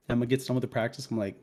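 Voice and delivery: ordinary talk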